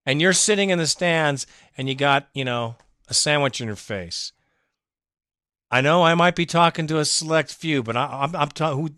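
ordinary delivery

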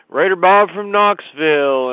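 shouted intro. Real, not acted. Fan calling in, shouting his name.

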